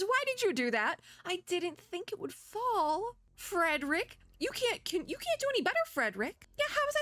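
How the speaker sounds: high-pitched, flustered protest; protesting squabble. Acted. Player squabbling with a book character over a dropped key, protesting. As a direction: bicker back in a high, defensive voice.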